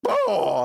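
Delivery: big high, loud "oh!"; booming mock challenge cry. Player imitating a rival throwing down the gauntlet, loud mock boom. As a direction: boom it out like a gauntlet hitting the ground.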